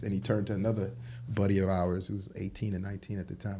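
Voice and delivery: normal talking voice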